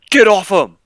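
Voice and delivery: loud, high barked command; cop yelling. Retold or imitated. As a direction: yell it like a furious cop.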